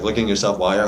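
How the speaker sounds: normal talking voice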